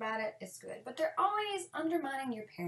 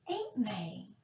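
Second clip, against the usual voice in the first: sugary greeting; 10 semitones higher than usual. Retold or imitated. Greeting the aunt sweetly.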